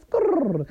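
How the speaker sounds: high skrrr noise; tire screech. Pretend. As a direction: screech like tires.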